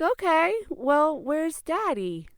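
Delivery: put-on high, loud little-kid voice; puzzled child. Child answering excited mother.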